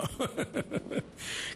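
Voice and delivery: breathless high laugh. Real, not acted. Host laughing at her own joke, breathless laugh. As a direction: burst out laughing.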